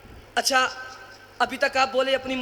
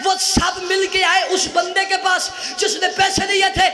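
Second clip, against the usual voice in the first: impassioned preaching; 5 semitones higher, 5 dB louder than usual. Acted. Preacher telling the congregation of children refusing the bribe, impassioned preaching. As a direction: drive the tale up to a ringing shout.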